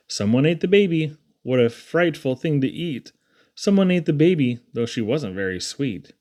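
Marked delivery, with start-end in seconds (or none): none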